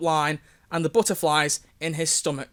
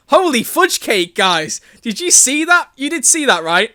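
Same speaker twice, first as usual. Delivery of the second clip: loud, high excited shout; terrible over-excited reading; 5 semitones higher, 6 dB louder than usual. Acted. Reader voicing Tobias asking the guys, over-excited. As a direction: exclaim it high and loud, overdone. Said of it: "that's my terrible reading".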